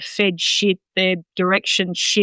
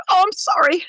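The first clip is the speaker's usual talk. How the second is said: high, meek little dog voice; sheepish pup apologizing after a firm no; apologetic dog; 11 semitones higher than usual. Retold or imitated. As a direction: squeak it like a dog backing down. Voicing a dog that backs down.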